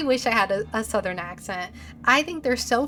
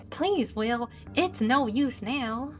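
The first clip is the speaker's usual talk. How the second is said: put-on Southern accent. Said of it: "is totally gonna have a Southern accent".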